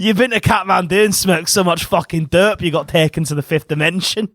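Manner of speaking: mock the writer in a broad Scottish voice; Scottish impression. Riffing on a comic writer's fifth dimension trip, hammed-up Scottish impression. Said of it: "our impressions".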